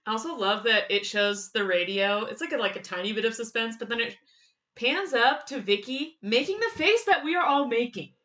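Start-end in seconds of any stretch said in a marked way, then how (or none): none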